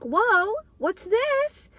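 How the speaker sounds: high, curious whoa, what's this; finding a strange chocolate bar; surprised whoa. Retold or imitated. Teen finding gold-wrapped chocolate, high and curious.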